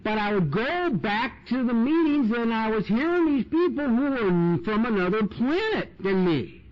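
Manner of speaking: ordinary talk, nothing marked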